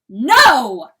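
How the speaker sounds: very loud explosive shout; furious refusal. Acted. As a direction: shout it down in fury. Rooster rejects coyote's love, attacks.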